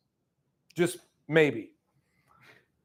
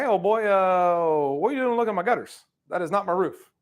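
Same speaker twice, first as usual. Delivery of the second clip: suspicious homeowner; 6 semitones higher than usual. Retold or imitated. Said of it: "I'm gonna come outside and be like".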